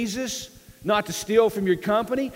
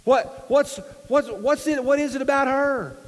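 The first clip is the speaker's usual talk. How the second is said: high, stammering disbelief; acted incredulity; 7 semitones higher, 3 dB louder than usual. A guy like me questioning his choice, incredulous. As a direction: stumble over the question, pitched well up.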